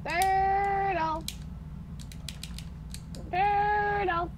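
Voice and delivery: high, long-held word; drawn-out call. Pretend. Player idly intoning turtle, high drawn-out call. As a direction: stretch the word out on a high note.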